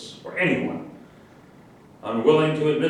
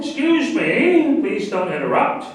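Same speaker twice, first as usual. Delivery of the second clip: indignant rebuke; 7 semitones higher, 6 dB louder than usual. Acted. Lecturer scolds heckler who interrupts him, indignant and loud. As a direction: bristle at the interruption, then clamp down.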